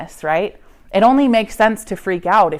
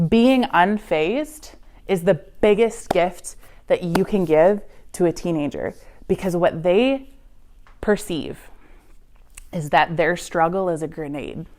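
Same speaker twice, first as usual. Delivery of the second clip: ordinary talk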